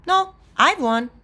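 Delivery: put-on high child's victory claim; defiant little sister. A narrator's reading. Little sister denies cheating to brother, defiant. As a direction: deny it and crow.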